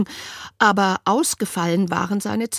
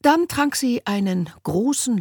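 plain reading voice

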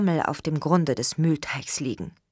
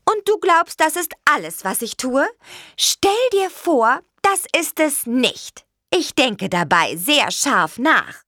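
indignant; 9 semitones higher, 3 dB louder than usual. A narrator's reading. Offended little witch defends her cloud-gazing, indignant. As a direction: protest in outrage, stretching each phrase. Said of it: "Empört setzte sich die Hexe auf".

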